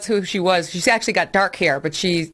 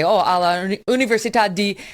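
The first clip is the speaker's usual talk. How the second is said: put-on speaking Italian with an Italian accent; halting student Italian. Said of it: "in, in Italian".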